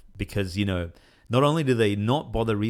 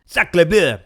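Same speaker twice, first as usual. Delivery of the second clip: hammed-up French accent, higher and louder; bad French accent; 7 semitones higher, 7 dB louder than usual. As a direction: read the review like a cartoon Frenchman. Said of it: "That's a terrible approximation of your accent".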